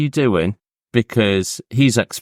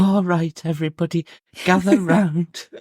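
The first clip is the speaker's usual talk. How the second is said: mocking a mum; 11 semitones higher than usual. Mocking the health-freak mum gathering kids round, high mock voice. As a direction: play the fussy health-freak mum calling everyone over.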